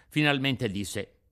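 usual speaking voice

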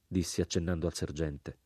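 ordinary delivery

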